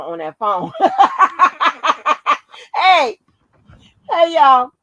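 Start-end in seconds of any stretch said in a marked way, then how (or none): 0.8-2.7 loud, high burst of laughter; real big laugh. Real, not acted. Cracks up at the story. 2.8-4.7 loud, high call to the listeners; worked-up exclamation. Real, not acted. Recovering from laughing fit.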